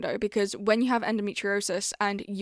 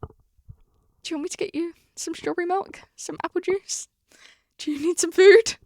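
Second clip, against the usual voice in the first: imitating doting boyfriend; 7 semitones higher than usual. Vlogger imitating boyfriend soothing her mood swings, gently doting. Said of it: "every single time he's just".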